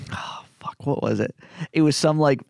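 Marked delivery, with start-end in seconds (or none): 0.1-1.3 real hushed aside, trying to remember; whispered self-questioning; muttering to self. Real, not acted. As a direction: mutter under your breath, searching your memory. Searching memory mid-story.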